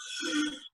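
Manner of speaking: a quick breathless gasp; sharp gasp. Retold or imitated. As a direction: gasp in for air.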